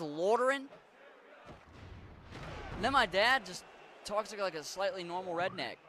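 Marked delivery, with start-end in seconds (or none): 0.0-0.7 put-on Yinzer accent. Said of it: "She says".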